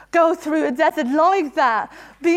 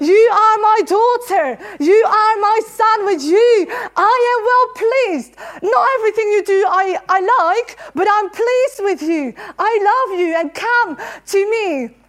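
louder, higher, near tears; impassioned proclamation; 4 semitones higher, 3 dB louder than usual. Acted. Preacher proclaiming God's love over the congregation, impassioned. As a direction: cry it out over the whole room.

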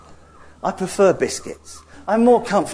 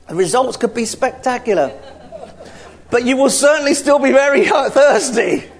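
excited; 8 semitones higher, 4 dB louder than usual. Acted. Escalating comic point.

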